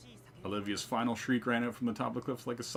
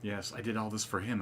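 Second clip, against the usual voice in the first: normal talking voice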